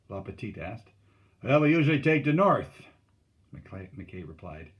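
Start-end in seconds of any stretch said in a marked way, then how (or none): 1.4-2.8 loud, raised-pitch reply; calling back. A narrator's reading. McKay answers Lapetite about camp, calling back. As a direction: project the answer across the water.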